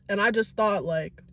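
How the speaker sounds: normal talking voice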